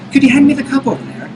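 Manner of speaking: high, precise imitation of a posh Oxford English accent. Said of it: "perfect Oxford accent".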